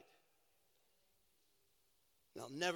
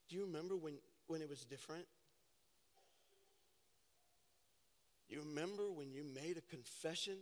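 intimate quiet appeal; 4 semitones lower, 9 dB quieter than usual. Real, not acted. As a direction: draw them in gently. Preacher drops to intimate quiet.

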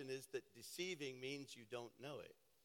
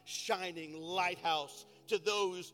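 loud, high fervent preaching; preaching at full force; 11 semitones higher, 11 dB louder than usual. Acted. Pastor rallying his church for the city, loud fervent preaching. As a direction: build the congregation up, shouting with fire.

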